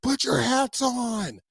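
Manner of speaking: high, drawn-out call down the street; parent's retold yell, stretched out; mock yell. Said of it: "I'm yelling down the street".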